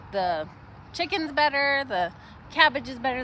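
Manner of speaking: ordinary talk, nothing marked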